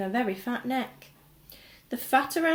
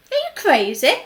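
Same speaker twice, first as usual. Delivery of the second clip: higher, louder indignant shout; 7 semitones higher, 11 dB louder than usual. A narrator's reading. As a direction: shout at him in disbelief. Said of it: "shouted a woman".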